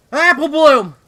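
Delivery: a desperate, high cry of the name "Apple Bloom!"; panicked scream. Acted. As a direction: scream her sister's name at full volume. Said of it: "Apple Bloom screamed".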